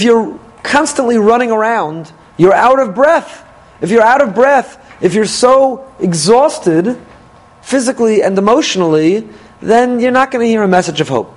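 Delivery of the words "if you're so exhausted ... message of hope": ordinary talk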